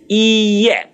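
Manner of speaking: "iye" as ee-yeh, with no t; "iye" drawn out slowly, ee-yeh; silent T. Shown as a demonstration. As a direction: show the ending "iye" as two vowels, t silent. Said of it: "the T there is silent".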